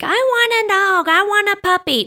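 mock child voice, pitched high; high-pitched imitation of nagging children; pleading kids. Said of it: "my kids are now on the kick".

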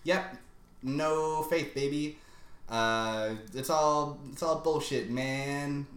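hammed-up punk rebellion; punk anarchist Locke. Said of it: "He gets real punk anarchist".

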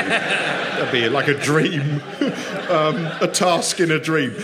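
slow, giggling through the words; laughing. Real, not acted. Laughing at his own sleeping policeman joke, slow giggly voice.

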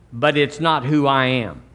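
ordinary delivery

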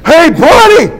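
yelled high and loud; furious call-out; high loud shout. Retold or imitated. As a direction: bellow it to stop the room. Confronting the guy, loud.

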